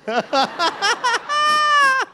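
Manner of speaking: high wailing fake cry; mock sob. Stood up from massage, mock crying. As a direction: burst into a hammed-up sob. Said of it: "I was like".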